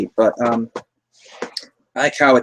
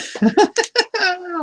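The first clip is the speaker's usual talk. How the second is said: high laugh. Real, not acted. Laughing at the scene.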